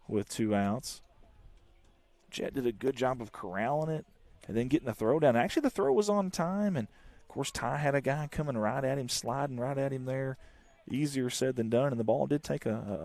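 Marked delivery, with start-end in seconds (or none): none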